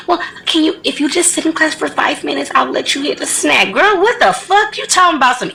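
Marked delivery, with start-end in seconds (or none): 0.1-3.7 mocking timid specialist. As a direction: mimic a weak pushover bargaining. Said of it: "she just let the little girl do".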